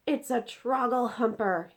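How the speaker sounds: loud, slow shout of fury and anguish; furious shout. Acted. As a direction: yell it out in anguish. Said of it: "he shouted".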